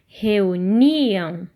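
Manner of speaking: Reuniam drawn out, NI held and pushed; stress on NI. Shown as a demonstration. Teacher confirming where the strong sound is, long stress on NI in Reuniam. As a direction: stretch the NI in Reuniam, then fall away. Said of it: "no ni".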